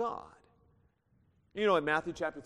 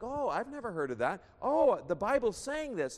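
higher-pitched imitation of impressed young believers; mock amazement; 6 semitones higher, 3 dB louder than usual. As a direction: play wide-eyed new believers marveling, mildly hammed up. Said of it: "They're like".

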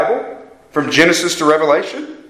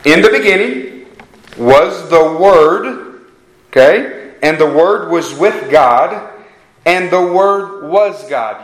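slow, louder reading, phrase by phrase; emphatic scripture reading; 2 semitones higher, 5 dB louder, slower than usual. Acted. Preacher reading John one to the congregation, slow and emphatic. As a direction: read it out deliberately, weighing every phrase.

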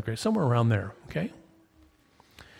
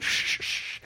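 unvoiced shushing like erasing chalk; eraser rubbing noise. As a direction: mimic an eraser wiping the board. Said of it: "she took an eraser, and she went".

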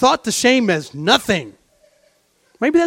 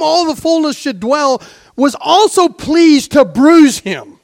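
higher, louder preacher voice, slowing on key words; impassioned preaching; 6 semitones higher, 6 dB louder than usual. Acted. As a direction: plead the mystery with full force.

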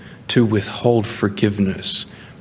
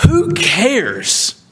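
dismissive preacher; 12 semitones higher than usual. Acted. Preacher exhorting the congregation.

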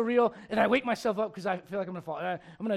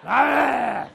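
playful shout; 2 semitones higher, 10 dB louder than usual. Real, not acted. Playful yell after laughter.

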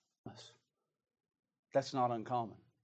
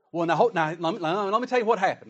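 loud, much higher flustered excuse; Sarah flustered, insisting on her side; defensive Sarah; 5 semitones higher, 6 dB louder than usual. Acted. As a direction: scramble to explain yourself. Sarah voiced defending herself.

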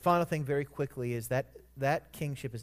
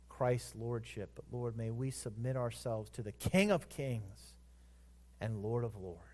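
prayer. Acted. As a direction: lead the church in a humble prayer. Closing prayer.

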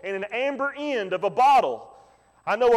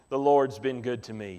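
much lower, soft, weary voice of the dying mother; quoting mama low and spent; frail mother's words; 10 semitones lower, 4 dB quieter than usual. Retold or imitated. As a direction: let it sink low and tired like a sick old woman. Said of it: "she said".

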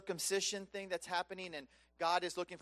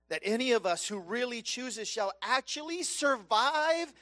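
louder and higher, slowing to a cry of wonder; rising preaching fervour; 9 semitones higher, 7 dB louder than usual. Real, not acted. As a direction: build from urgency to amazed exclamation. Preacher building to climax.

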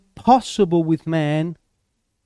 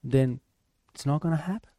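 much softer, lower finish; quiet close; 6 semitones lower, 9 dB quieter, faster than usual. Real, not acted. Preacher settling the point, soft and low. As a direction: drop it to a hush.

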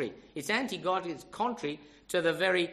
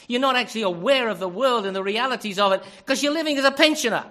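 loud, raised pulpit rebuke; forceful preaching; 3 semitones higher, 10 dB louder than usual. Real, not acted. Preacher chides older men chasing importance, forcefully. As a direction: press each charge home at full voice.

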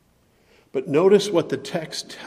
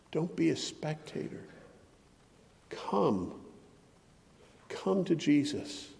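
gentle invitation; 9 dB quieter than usual. Real, not acted. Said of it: "soft altar-call invitation".

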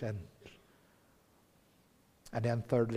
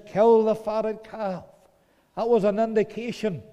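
exultant preaching; 7 semitones higher, 8 dB louder than usual. Real, not acted. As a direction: proclaim the feast with joy. Excited retelling of the father.

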